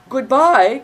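high, loud goodbye call; cried goodbye. A narrator's reading. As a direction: shout it after the rider.

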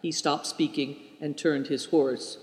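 plain reading voice

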